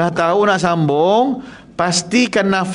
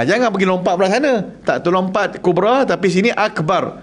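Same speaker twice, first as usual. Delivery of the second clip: ordinary talk, nothing marked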